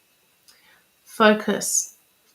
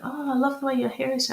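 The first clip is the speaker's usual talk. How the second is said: lightly voiced gushing compliment, higher and quick; giving a compliment; 6 semitones higher, 3 dB quieter, faster than usual. A friend paying a compliment, mock gushing higher voice. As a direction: toss off a bright mock compliment, a bit faster. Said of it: "someone gave you a compliment".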